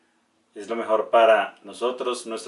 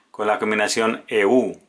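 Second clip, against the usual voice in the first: ordinary delivery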